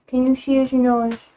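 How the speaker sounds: normal talking voice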